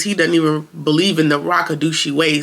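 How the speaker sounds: ordinary delivery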